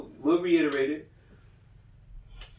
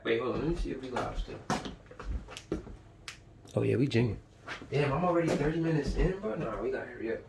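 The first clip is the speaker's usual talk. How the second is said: quiet aside; 5 semitones lower, 9 dB quieter than usual. Real, not acted. Aside checking the livestream.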